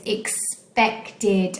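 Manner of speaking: "expected" broken up as ex, pec, ted; slow, divided "expected"; split into syllables. Shown as a demonstration. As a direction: say "expected" slowly in three beats. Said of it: "has three".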